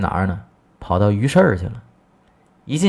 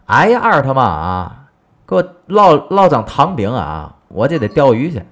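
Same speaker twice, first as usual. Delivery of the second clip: husband hollering for sweet pancakes; shouting to wife; 5 semitones higher, 5 dB louder than usual. Acted. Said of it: "一回家喊开了".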